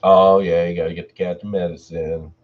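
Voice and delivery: plain reading voice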